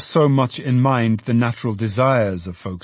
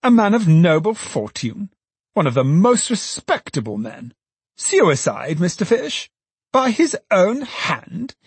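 high, incredulous outburst; aghast, high-pitched questioning; shocked exclaiming; 9 semitones higher, 3 dB louder than usual. A narrator's reading. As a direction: exclaim in disbelief, pitch climbing to the end. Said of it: "exclaimed the alderman".

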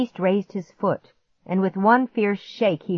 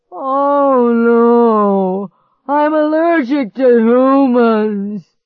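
high, loud, slow anguished monster wail; groaning monster; 6 semitones higher, 10 dB louder, much slower than usual. Acted. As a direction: wail it in despair.

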